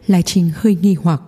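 usual speaking voice

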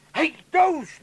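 high, loud hey Toos; loud hearty greeting. Acted. Father hailing his daughter, loud and hearty. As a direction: greet her across the room.

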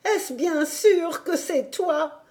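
can it really be you; old woman laughing through tears; tearful grandmother. Acted. Grandmother, disbelieving joy.

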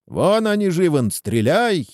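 high, urgent call from afar. A narrator's reading. Henchman calls out from below. (взволнованный оклик)

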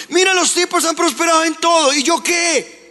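high-pitched, acted grievance; aggrieved complaint. Preacher voices the people complaining to God, aggrieved.

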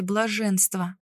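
usual speaking voice

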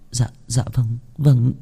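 stammering, voice caught and unsteady. A narrator's reading. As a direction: stumble over the words in shock. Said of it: "Cẩm Tú lắp bắp". (lắp bắp ngập ngừng)

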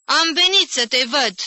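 put-on high, loud shout to the old woman. A narrator's reading. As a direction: shout it so she can hear. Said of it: "țipă la rândul Jim".

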